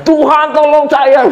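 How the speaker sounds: high, drawn-out mock holler; hammed-up cry for help; mock shouted prayer. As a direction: parody praying through a loudspeaker. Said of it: "Kita teriak-teriak gitu kan".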